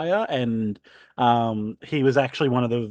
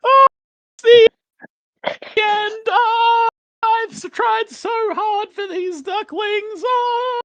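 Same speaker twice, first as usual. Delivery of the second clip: very high, loud shrieking wail; hysterical duck; 5 dB louder, slower than usual. Acted. Mildred shrieking hysterically about her ducklings, very high. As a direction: wail like the world is ending. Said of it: "running around hysterical going".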